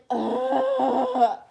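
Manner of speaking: long, loud mock groan; exasperated ugh. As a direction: groan in hammed-up dismay. Mock reaction to friend's growth spurt.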